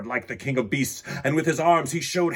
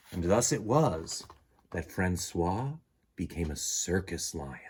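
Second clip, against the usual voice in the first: ordinary delivery, nothing marked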